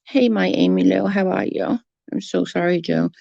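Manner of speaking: normal talking voice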